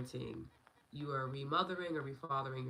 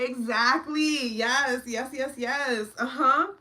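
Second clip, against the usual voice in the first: high, loud, slow and laughing; overwhelmed with happy laughter; laughing joy; 11 semitones higher, 14 dB louder, much slower than usual. Real, not acted. Joyful overwhelmed laughing reaction.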